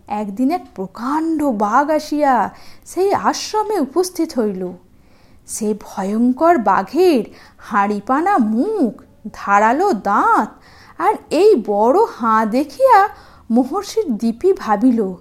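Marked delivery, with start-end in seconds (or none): none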